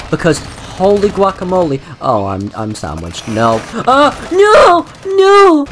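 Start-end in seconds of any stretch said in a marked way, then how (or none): 3.7-5.7 high, loud panicked yell; desperate cry of no. Real, not acted. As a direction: shout it like you're about to die. About to be killed.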